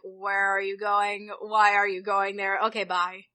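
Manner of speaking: low, slow, uninterested questioning; imitated bored guard. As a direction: lightly mimic a guard going through the motions. Imitating indifferent airport border guards.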